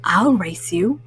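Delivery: a mellow cool cat suggesting fun; Pete the Cat voice. Acted. Mom reading to kids, voicing cool cat Pete.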